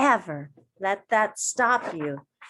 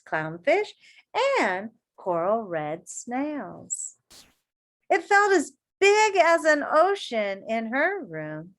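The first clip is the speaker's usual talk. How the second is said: put-on reading to children; 6 semitones higher than usual. A narrator's reading. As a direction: read it to a circle of kids. Reading picture book aloud.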